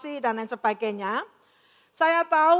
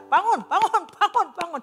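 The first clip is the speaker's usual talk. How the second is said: higher, louder repeated call to wake up; urgent wake-up call; 6 semitones higher, 3 dB louder than usual. Retold or imitated. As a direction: rouse a patient coming out of anaesthesia, insistently. Nurse slapping her awake.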